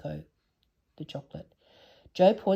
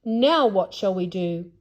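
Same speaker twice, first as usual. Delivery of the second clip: louder, growling alarm; growled loudly; 3 semitones higher, 7 dB louder than usual. Acted. Father Bear warning everyone, mild loud growl. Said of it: "he growled loudly".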